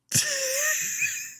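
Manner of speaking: high wheezy laugh; long airy wheeze of amusement; wheezing laugh. Real, not acted. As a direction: laugh breathlessly to yourself. Laughs about emotes.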